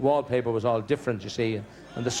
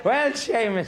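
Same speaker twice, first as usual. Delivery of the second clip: coy granny voice; 8 semitones higher, slower than usual. Retold or imitated. Old wife coy on the wedding night.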